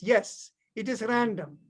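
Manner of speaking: ordinary talk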